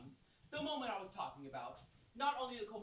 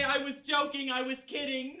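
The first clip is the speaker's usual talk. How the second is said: mock plea; 6 semitones higher, 13 dB louder than usual. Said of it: "Do you say".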